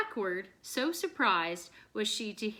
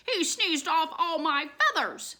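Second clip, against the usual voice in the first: angry; 6 semitones higher, 4 dB louder than usual. A narrator's reading. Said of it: "angrily stomping her foot".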